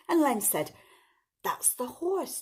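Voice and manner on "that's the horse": higher-pitched thief voice; raised-pitch bad guy, lightly played; storybook thief. A narrator's reading. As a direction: lightly voice a thief finding his way. Thief Len speaks in story.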